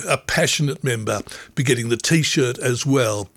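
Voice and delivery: ordinary talk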